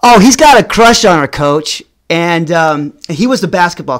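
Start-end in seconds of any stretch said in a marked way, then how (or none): 0.0-1.8 louder mock tattling to the teacher; buddy outing him to the coach, teasing; teasing call-out. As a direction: blurt it out to the whole class. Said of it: "he goes".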